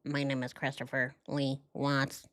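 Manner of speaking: low, soft flat monotone; robot voice. Retold or imitated. Mimicking the husband on the polygraph, low robotic monotone. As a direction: say it like a machine. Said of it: "Yeah, the robot".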